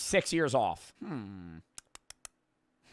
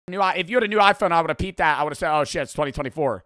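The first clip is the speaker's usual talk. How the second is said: loud, high, indignant tirade; exasperated rant; 5 semitones higher, 8 dB louder, faster than usual. Real, not acted. Streamer blaming an old iPhone for ruining the run, exasperated rant. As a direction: rant loudly over a ruined run. Ranting over misleading iPhone photo.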